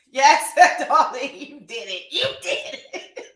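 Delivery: laughing through the words; can't stop giggling at a viewer. Real, not acted.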